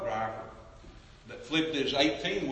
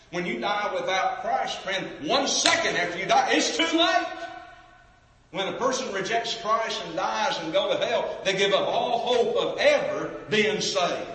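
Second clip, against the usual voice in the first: high, loud warning cry; fervent preaching; 5 semitones higher, 4 dB louder than usual. Acted. Preacher warning sinners it's too late, rising fervent cry. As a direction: press the urgency up.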